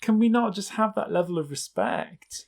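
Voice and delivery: exasperated appeal. Retold or imitated. Podcaster to family about their notes, exasperated. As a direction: protest it as a fair request ignored.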